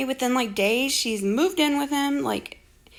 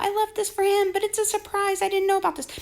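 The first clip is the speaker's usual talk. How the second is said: mocking quote; 9 semitones higher than usual.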